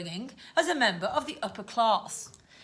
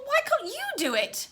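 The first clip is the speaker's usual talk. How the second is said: high-pitched, posh challenge; defiant girl's retort; 13 semitones higher than usual. Acted. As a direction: throw it back at them, high. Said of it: "she said defiantly".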